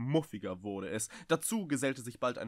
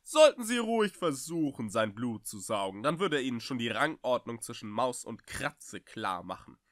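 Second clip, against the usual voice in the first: ordinary delivery, nothing marked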